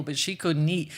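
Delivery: ordinary delivery, nothing marked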